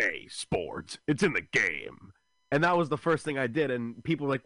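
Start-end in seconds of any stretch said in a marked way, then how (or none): 0.0-2.1 low, slow logo announcer voice; EA Sports. Acted. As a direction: draw out a deep video-game slogan. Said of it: "you know the E A Sports logo".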